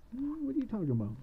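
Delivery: high-pitched baffled question; puzzled, pitched well up; puzzled witch. Acted. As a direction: ask it high, at a normal volume. Witch annoyed at being questioned.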